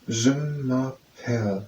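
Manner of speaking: slow, drawn-out Je m'appelle; slow model. Shown as a demonstration. Teacher models Je m'appelle slowly for the learner. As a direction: stretch each syllable of Je m'appelle.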